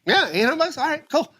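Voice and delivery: high, offhand put-on voice; shop owner agreeing. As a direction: imitate a casual, high-voiced yes.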